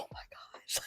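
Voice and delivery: breathless hushed oh my gosh; awed whisper. Retold or imitated. Traveler landing in Amsterdam, whispering to herself in awe. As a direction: hush it like you can't believe you're here.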